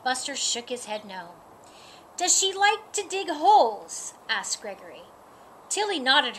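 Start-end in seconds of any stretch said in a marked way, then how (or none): 2.2-4.1 eager. Acted. Gregory the dog asks.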